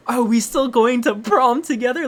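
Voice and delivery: high, loud incredulous repeat; mocking echo. Boy parroting her prom text, loud mocking disbelief. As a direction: throw the question back in mock outrage. Throws her question back, outraged.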